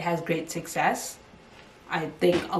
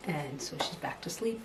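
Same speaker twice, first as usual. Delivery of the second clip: whispering; 9 dB quieter than usual. Real, not acted. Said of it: "She just needed a little feed".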